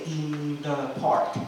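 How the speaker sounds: IN THE PARK said slowly, each word drawn apart; slow learner reading "In the park" word by word. Retold or imitated. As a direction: say "In the park" unlinked, every word stretched out. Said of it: "so they will say".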